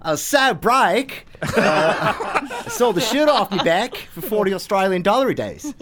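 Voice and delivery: playful Australian twang, breaking into laughter; Australian accent. Shown as a demonstration. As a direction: put on a hammy Australian accent. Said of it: "in an Australian accent".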